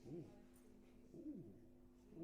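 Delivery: usual speaking voice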